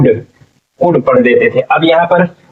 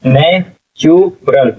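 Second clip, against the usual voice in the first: Natural very slowly as ने, चु, रल; syllable by syllable; much slower than usual. Shown as a demonstration. Teacher sounding out natural for students, slow syllables. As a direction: break natural into parts with clear pauses. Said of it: "क्या हो जाएगा".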